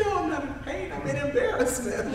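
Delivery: high-pitched protest through laughter; laughing protest. Retold or imitated. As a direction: insist it while trying not to laugh. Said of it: "And now I'm laughing".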